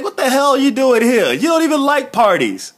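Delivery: high, teasing you-don't-belong-here voice; imitating Kofi. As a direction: act baffled at the uninvited guest. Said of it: "Kofi's like".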